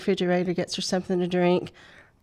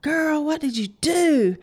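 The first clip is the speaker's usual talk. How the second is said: put-on scolding little girl; 8 semitones higher, 3 dB louder than usual. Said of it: "She said".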